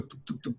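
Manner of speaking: breathy unvoiced tuk tuk heartbeat; whispered heartbeat sound. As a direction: mimic a racing heart under your breath. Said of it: "मेरा heart beat". (फुसफुसाकर दिल की धड़कन "तुक, तुक")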